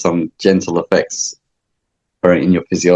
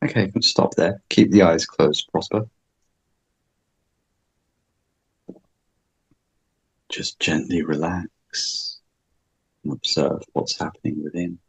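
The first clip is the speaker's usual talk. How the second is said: guided meditation; 2 semitones lower, 7 dB quieter than usual. Acted. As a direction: ease them into calm quietly.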